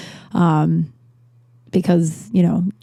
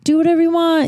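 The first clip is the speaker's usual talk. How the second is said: higher-pitched imitation of a dismissive relative; 10 semitones higher than usual. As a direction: toss it off as a retold shrug-off. Voices relatives' reaction in story.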